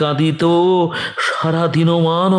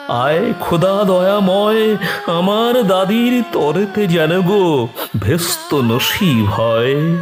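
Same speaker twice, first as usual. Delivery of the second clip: raised, tearful-edged plea to God; higher imploring cry that falls away at the end; pleading prayer; 5 semitones higher than usual. Acted. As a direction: implore God for mercy within the poem. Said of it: "হাত জোড় করে দয়া মাগো দাদু".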